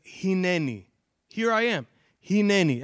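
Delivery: "Hineni" said hee-neh-NEE, loud and stressed; Hebrew hineni. Shown as a demonstration. Preacher modelling the word for the congregation, ringing out. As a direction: proclaim "Hineni" with full Hebrew vowels. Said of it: "Everybody can say that".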